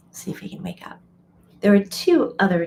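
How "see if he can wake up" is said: real whisper beside the sleeping cat; a soft whispered aside. Real, not acted. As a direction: hush it so you don't startle him. Hushed aside near sleeping kitten.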